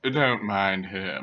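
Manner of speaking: slow, smooth, dismissive; disdainful mellow voice. Acted. Son dismissing his professor father, mellow disdain. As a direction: draw it out slowly with mild disdain. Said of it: "The voice had disdain for the professor".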